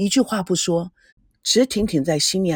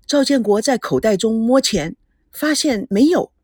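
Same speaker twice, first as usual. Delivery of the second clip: plain reading voice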